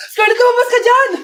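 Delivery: high, loud tearful call; desperate tearful yell; crying shout. Acted. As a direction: yell her name through tears. Friend calling from outside, crying.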